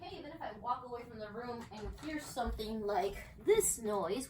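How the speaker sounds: ordinary delivery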